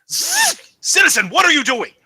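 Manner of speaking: loud, high-pitched shout at a citizen; sert azar. Acted. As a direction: shout it as a sharp challenge from an authority. Computer confronts a citizen.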